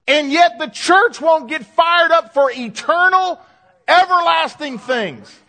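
loud, high, slow and drawn out; rally the church with full-force exhortation; impassioned, stretched-out pulpit cry; fired-up preaching. Real, not acted.

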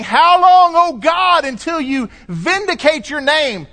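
high, loud anguished plea to God; impassioned pleading prayer; pleading cry. Retold or imitated. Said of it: "all of the prayers ... of saying".

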